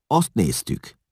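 high-pitched defensive reply; making excuses. A narrator's reading. Younger Hun explains himself to Eszlasz, defensive and high. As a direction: justify yourself to the master. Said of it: "mentegetőzött a fiatalabbik hún".

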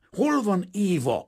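slow, high, loud roar. A narrator's reading. Painter exploding at his fiancée's father, roaring. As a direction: bellow it, drawing out each word. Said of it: "ordított föl Kazimír".

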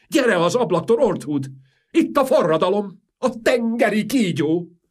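high, loud frantic call; panicked cry; alarmed warning. Acted. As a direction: shout a warning in terror. King warns of revolution.

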